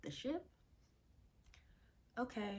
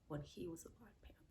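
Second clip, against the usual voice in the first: whispered; 3 semitones lower, 13 dB quieter, faster than usual. Real, not acted. Hushed aside.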